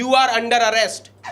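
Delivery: slow, overdone police dialogue; eager schoolboy cop, slow and theatrical; stagey cop line. Retold or imitated. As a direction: spell out each word like a hammy movie cop. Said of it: "मैंने जाते ही पहला dialogue बोल दिया".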